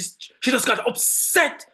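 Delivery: ordinary talk, nothing marked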